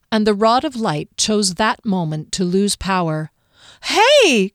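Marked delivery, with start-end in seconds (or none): 3.8-4.5 loud high cry. A narrator's reading. Kier as the light rod fails, crying out. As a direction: shout at the dying light. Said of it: "Kier cried".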